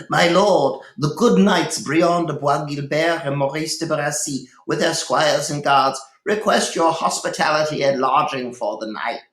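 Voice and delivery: high, formal announcement at the door; servant announcing. Acted. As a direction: announce the guests grandly.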